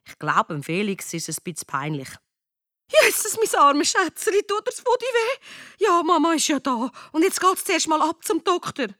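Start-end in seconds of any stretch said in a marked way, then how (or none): 2.9-8.9 high, cooing mother voice; fussing, consoling mother. Acted. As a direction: coddle a hurt child, high-pitched. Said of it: "tröstet ihn".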